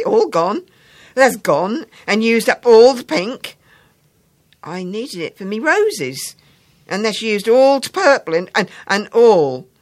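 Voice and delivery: hammed-up childish grievance; aggrieved schoolboy. Boy complains about used pink.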